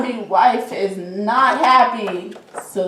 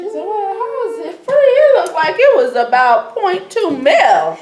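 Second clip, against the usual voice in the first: lightly voiced teen girl, pitched way up; mock high girly voice; 11 semitones higher, 6 dB louder than usual. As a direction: ask it like a startled teenage daughter, high. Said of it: "She's like".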